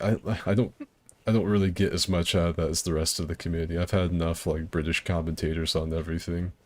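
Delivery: ordinary delivery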